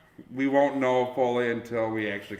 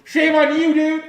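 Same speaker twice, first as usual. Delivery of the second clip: squeaky high, loud mock scolding; silly high voice; 14 semitones higher, 9 dB louder than usual. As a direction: echo it back in a squeaky falsetto. (gúnyos, magas hangú fejhang)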